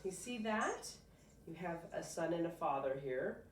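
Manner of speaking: usual speaking voice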